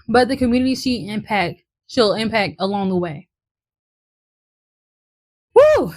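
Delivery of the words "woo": loud, high cheer of relief; excited whoop. Real, not acted. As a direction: let out a big woo. Relief after finishing speech.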